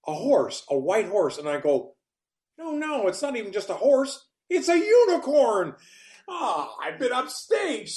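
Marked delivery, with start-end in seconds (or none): 2.6-5.7 high-pitched amazement, building louder to the reveal; astonished. Retold or imitated. Acted astonishment in retold dream. 6.3-7.9 high, slow lament at being outdone; hammed-up wail of defeat; mock dismay.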